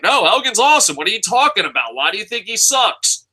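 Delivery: high-pitched mock disbelief, quick in the middle; incredulous defense. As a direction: protest like an indignant fan defending his favorite. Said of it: "I used to be like".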